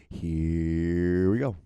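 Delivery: one long, loud stretched 'here'; drawn-out cue. Real, not acted. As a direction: announce it with a hugely elongated first word. Cueing up the video segment.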